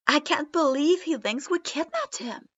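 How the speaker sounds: loud, high disbelieving yell; flabbergasted yell. A narrator's reading. Deidara to the room about the kid, flabbergasted yell. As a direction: bellow in utter disbelief. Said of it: "Deidara yelled, completely flabbergasted".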